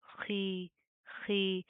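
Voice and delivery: खी with a thick, scraped throat fricative; long खी with a full Arabic kh; heavy khee. Shown as a demonstration. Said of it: "ज़ेर के साथ भी मोटा पढ़ा जाएगा".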